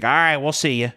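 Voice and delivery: lightly put-on hospital staffer voice; imitating hospital worker. Hospital worker dismissing the raccoon-bite patient, imitated. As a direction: play the staffer's casual send-off in another voice. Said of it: "She's like".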